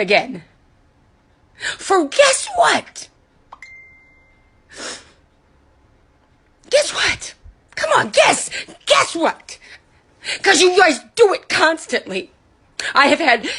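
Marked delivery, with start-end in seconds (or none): 1.6-9.6 high, strained and crying, taunting guess what; real upset, taunting; bitter taunt. Real, not acted. Upset rant to viewers, taunting. 10.4-12.3 tearful accusation; real crying rant. Real, not acted.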